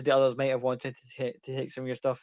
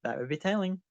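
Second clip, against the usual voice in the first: coy, higher lilt lifting at the end; sly smile; 6 semitones higher than usual. Acted. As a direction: tease slyly, keeping a secret. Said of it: "a very sly smile appears and goes".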